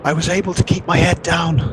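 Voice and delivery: shouting to be heard in the wind; shouting over wind. Acted. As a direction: call it out over the storm. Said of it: "he's having to shout above that wind".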